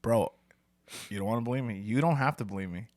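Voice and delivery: ordinary talk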